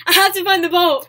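loud, hammed-up urgency; acted panic. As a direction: cry out like a frantic cartoon parent. Acting out animated film scene.

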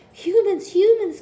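high, loud frightened shout; alarmed cry. A narrator's reading. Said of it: "Cuddlepie cried".

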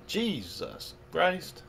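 hammed-up slow outburst at the kids; mock yell. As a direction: cry it out slowly like an exasperated parent.

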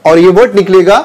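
ordinary talk, nothing marked

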